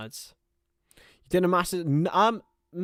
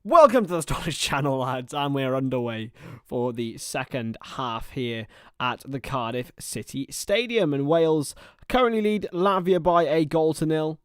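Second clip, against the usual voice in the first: sports commentator; 5 dB louder than usual. Acted. Streamer welcoming viewers to the second half, commentator delivery. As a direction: open the half like a broadcast commentator.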